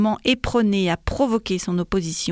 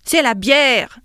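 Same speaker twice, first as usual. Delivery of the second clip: loud, higher outburst; exclaimed at raised volume; crying out; 4 semitones higher, 7 dB louder, slower than usual. A narrator's reading. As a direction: cry it out hotly. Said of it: "s'écria Dindonneau".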